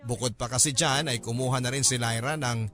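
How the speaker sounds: ordinary talk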